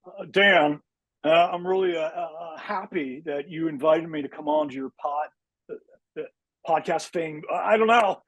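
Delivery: halting, over-the-top actor impression with pitch swoops; Nick Cage. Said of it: "your Nick Cage impression is probably the best".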